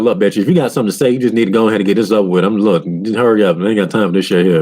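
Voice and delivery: fast, fed-up rattling; impatient rush. Retold or imitated. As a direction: hurry through it, sick of waiting. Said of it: "You're like".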